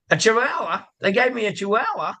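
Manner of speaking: high-pitched, incredulous punchline; mock disbelief. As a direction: act astonished, pitch jumping up. Joke punchline, [name] feigns shock.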